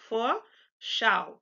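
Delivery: Vorschau split up as "for", pause, "show" rhyming with ow. Shown as a demonstration. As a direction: break Vorschau into two careful parts, F start. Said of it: "F for the V".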